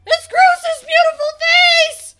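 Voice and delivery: very high, loud squeal; high-pitched theatrical outburst; high exclamation. Real, not acted. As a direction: squeal it out dramatically. Finds the stolen face.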